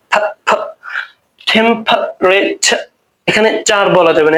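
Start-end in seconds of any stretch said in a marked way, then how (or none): 0.1-0.6 ফ said as a breathy "f"; sound demo. Shown as a demonstration. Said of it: "পির উচ্চারণ করতে হবে ফ". 1.5-2.8 টেম্পারেচার slowly, with ঠ-like t and ফ-like p; slow native pronunciation. Shown as a demonstration. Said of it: "টির উচ্চারণ করতে হবে ঠায়ের মতো".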